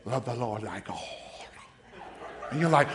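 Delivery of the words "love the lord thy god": breathy, reverent whisper; hushed parody of a pious Jesus; mock holy whisper. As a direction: breathe it like a saintly movie Jesus. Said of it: "and he's so smooth".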